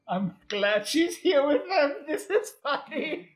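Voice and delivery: high, slow words through laughter; laughing while half crying. Real, not acted. Fond reaction to a character.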